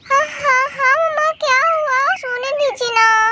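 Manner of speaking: put-on high, drowsy little kid just woken up; sleepy child voice. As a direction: whine like a child who wants to keep sleeping. (ऊँची, नींद भरी बच्चे की आवाज़)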